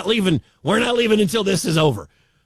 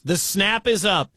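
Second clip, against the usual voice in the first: usual speaking voice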